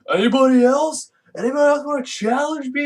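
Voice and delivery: high, loud hammed-up taunt; taunting Bane. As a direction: bellow the challenge at the crowd. Bane taunting after fight.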